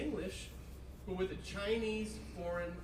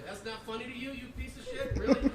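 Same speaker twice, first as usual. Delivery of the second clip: ordinary talk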